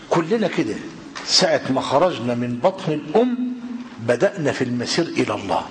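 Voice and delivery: normal talking voice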